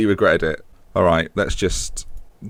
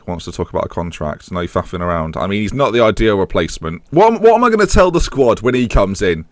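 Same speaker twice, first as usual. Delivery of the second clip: ordinary talk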